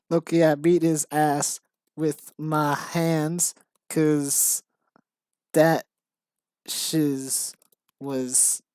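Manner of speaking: slow mock gangster, drawn-out words; hammed-up gangster, word by word. Writer reading out own embarrassing script, mock gangster. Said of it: "stereotype of how gangsters talk".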